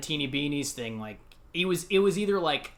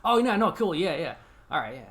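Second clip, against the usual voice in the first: feigned nonchalance; 4 semitones higher, 3 dB louder than usual. Retold or imitated. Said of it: "And I was like".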